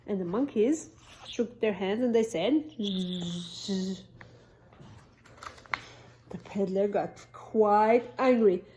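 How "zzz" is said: teasing buzzy monkey reply; mock them with a buzz. Monkeys buzzing back at the peddler.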